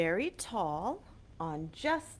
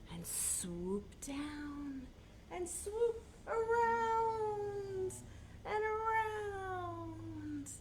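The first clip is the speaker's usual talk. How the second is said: swooping voice; 10 semitones higher, 4 dB quieter, much slower than usual. Acted. Acting swirling flight.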